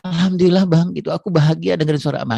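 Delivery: high, loud, swooning wife; wife moved that her husband asks about her; touched wife. Retold or imitated. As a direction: melt at his concern. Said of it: "dia bilang gitu".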